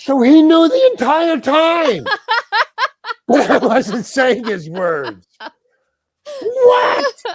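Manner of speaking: high, loud, drawn-out comic disbelief; loud incredulous outburst. Retold or imitated. Actor learning the director wrote the script, loud incredulous outburst. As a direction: cry out in hammed-up shock, laughter creeping in. Storyteller's horrified realization at the news.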